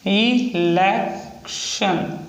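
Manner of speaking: extremely slowed dictation; very slow stretched word. Shown as a demonstration. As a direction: pull the word out syllable by syllable. Repeats word while writing.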